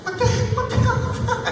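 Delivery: very high-pitched comic disbelief; shocked squeal. Real, not acted. Spat on a second time.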